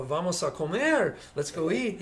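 a slow, loud mock Spanish invitation. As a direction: draw it out as a fake Spaniard. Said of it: "Don, what is wrong with you?".

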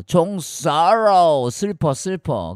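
loud, drawn-out mock lament; mock sorrow. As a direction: wail the mnemonic with hammed-up grief. Replays the sorrow mnemonic in character.

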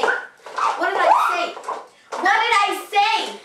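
angry. Acted. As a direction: snap it at a friend who ignores you. Scolding a friend who ignores her.

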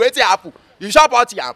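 normal talking voice

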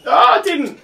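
sudden high yelp, then a quick laugh-off; surprised yelp. Real, not acted. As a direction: caught off guard as the drop goes wrong. Startled during the drop.

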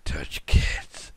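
low, forceful stage whisper; mock-creepy whisper. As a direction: whisper it as a tasteless mock villain. Mock-sinister joke, then retracted.

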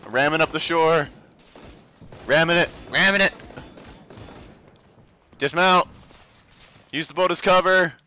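shouting orders. Real, not acted. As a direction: bark the orders over gunfire. Beach assault under fire.

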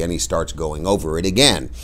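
plain reading voice

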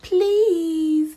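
high, loud begging chorus. Acted. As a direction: plead like eager little creatures. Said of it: "they begged".

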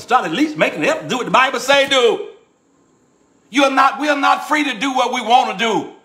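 high-pitched, forceful fussing. Real, not acted. Preacher telling the church to obey the Bible, fussing. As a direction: fuss at them, pitched well up. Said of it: "Am I sound like I'm fussing?".

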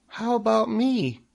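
very high, piping question; tiny creature piping up; third little spider. A narrator's reading. Third spider asks Wilbur, high little voice. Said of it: "said the third spider".

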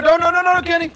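high, loud panicked no's; frantic pleading. Real, not acted. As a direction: beg him not to go. Teammate's robot about to die.

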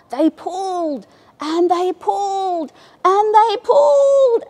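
building to a loud, high heave; final big pull. A narrator's reading. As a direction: strain harder on each pull.

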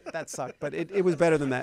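usual speaking voice

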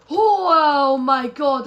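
loud, high cry of surprise; high "oh" settling into amazement; astonished. Real, not acted. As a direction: burst out in disbelief. Stunned by a lucky game move.

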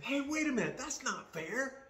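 loud, high-pitched indignant protest. Acted. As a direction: protest loudly like someone cut in line. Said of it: "And that's indignant".

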